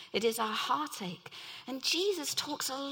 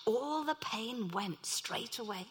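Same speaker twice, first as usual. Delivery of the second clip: ordinary talk, nothing marked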